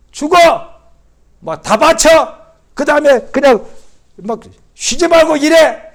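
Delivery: loud, high driving commands; tyrant's orders. Retold or imitated. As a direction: shout them like a slave driver. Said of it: "이러신 분이 아니라".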